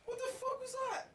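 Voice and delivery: spooked, voice leaping very high; startled. Real, not acted. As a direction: react to a sudden bang in real alarm. Startled by a noise at the window.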